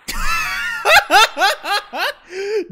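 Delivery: high, shrieking burst of laughter; loud, high-pitched laughing fit; loud cackle. Real, not acted. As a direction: let out a long high cackle. Cackles after own joke.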